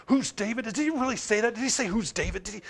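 acted-out fuming under the breath; angry muttering to oneself. Preacher playing angry David stewing to himself, muttered indignation. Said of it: "you're talking to yourself the whole time".

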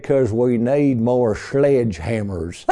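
slow, drawn-out bad German accent; southern-drawl German accent. Retelling the framer's joke, mock German accent in southern drawl. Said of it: "in this southern drawl, imitate a German accent".

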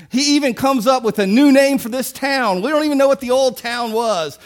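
ordinary delivery

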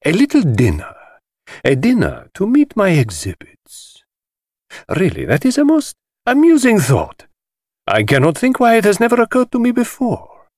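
high, showy voice of a flamboyant collector; theatrical host. Acted. The host lecturing the detective, grand and high-pitched. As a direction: relish every word like a showman.

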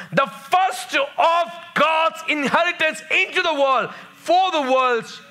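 high-pitched, ringing scripture proclamation; emphatic preaching. Real, not acted. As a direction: drive each phrase home like a preacher.

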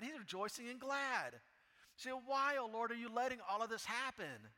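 loud, high impassioned preaching; preaching at full force. Acted. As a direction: cry it out to God with full fervor. Preaching second complaint at full force.

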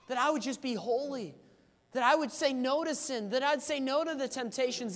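high, loud pleading prayer at full force; impassioned prayer. Acted. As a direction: cry out to God, begging for revival. Fervent prayer acted out aloud.

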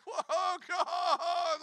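high, breathless wonder; overwhelmed by sudden holiness; awed whoa. Real, not acted. Telling the church how God's presence hit him, high and awed.

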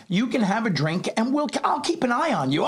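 high mock-soothing mother, laughing at the end; lightly mimicked mother; mother reassuring. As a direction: imitate mom promising to watch him. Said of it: "She goes".

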